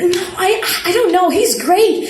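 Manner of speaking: flustered, high-pitched. Acted. Flustered reply about him.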